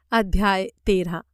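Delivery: plain reading voice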